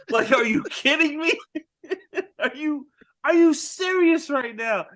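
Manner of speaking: high-pitched, half-laughing mock disbelief; incredulous. [name] rejecting Mike near his sister, mock incredulity. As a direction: act out disbelief, voice way up.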